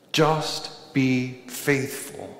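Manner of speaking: normal talking voice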